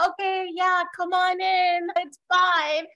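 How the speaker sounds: high, falsely breezy welcome; forced welcome. Acted. Man hiding the truth lets the dad in, forced welcome. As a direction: cover up panic with an airy invitation. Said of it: "But he can't, like, tell him that".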